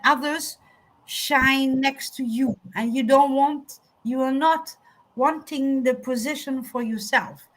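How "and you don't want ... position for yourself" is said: ordinary talk, nothing marked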